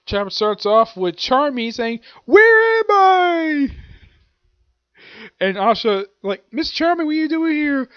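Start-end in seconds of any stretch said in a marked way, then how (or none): 2.2-3.7 high, loud, stretched-out wail; drawn-out cry. Acted. Acting out manga character's confused cry. 6.5-7.9 high-pitched character voice, taken aback; startled question. Acted. Acting out Asha's startled question.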